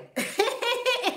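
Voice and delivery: high, loud burst of giggles; helpless high cackle; real cackling laugh. Real, not acted. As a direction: crack yourself up mid-sentence. Cracks herself up mid-sentence.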